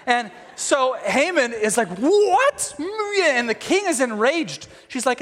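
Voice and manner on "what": high, rising, drawn-out disbelief; hammed-up shocked yelp; mock shock. Haman hears himself accused by the queen, mock shock.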